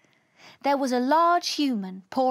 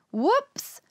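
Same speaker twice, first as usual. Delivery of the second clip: high, rising startled whoops; sudden high-pitched alarm; startled exclamation; 9 semitones higher than usual. Acted. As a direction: yelp it as if caught off guard. Alien narrowly avoids an enormous creature.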